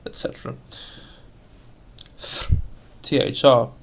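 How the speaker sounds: ordinary delivery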